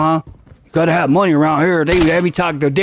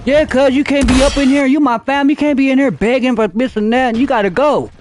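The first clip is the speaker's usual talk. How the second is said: cousin kicking him out; 6 semitones higher than usual. Retold or imitated. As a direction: scold a begging cousin to leave. Cousin throws him out, retold.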